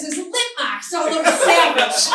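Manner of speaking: a Boston mom cracking up mid-quote; laughing Boston mom. Retold or imitated. Comic telling of mom's find, laughing Boston accent. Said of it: "in her very Boston accent".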